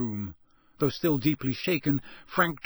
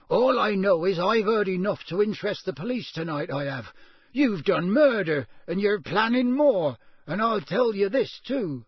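loud, raised, accusing voice; steadier, defiant; 7 semitones higher, 5 dB louder than usual. A narrator's reading. Intruder threatening murderers with the police, steadily defiant. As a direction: build into a high, loud accusation. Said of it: "his voice growing steadier".

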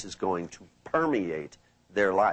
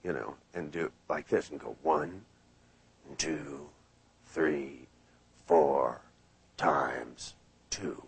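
hypnotic trance voice; 6 semitones lower, slower than usual. Shown as a demonstration. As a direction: lull them down one notch at a time. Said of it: "I like to say, like, for example".

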